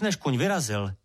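ordinary delivery, nothing marked

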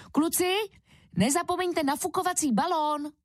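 put-on high-pitched mum calling to her boys; mother reminding. A narrator's reading. Mum to her two boys before the pool, reminding. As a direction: remind the children, voice lifted high. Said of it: "připomínala Ferdovi a Kubovi".

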